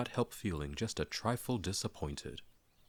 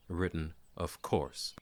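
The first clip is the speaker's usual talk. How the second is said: usual speaking voice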